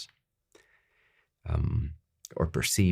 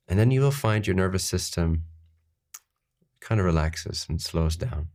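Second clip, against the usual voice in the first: normal talking voice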